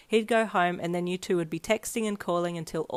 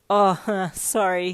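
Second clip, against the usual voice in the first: sheepish apology with a little laugh; embarrassed chuckle; 7 dB louder, much slower than usual. A narrator's reading. Boy rubbing his neck after being teased, chuckling embarrassed. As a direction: chuckle awkwardly through the sorry. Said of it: "he chuckled with embarrassment".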